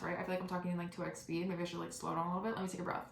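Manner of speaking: usual speaking voice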